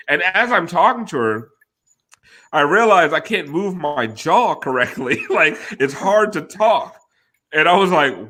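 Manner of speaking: ordinary delivery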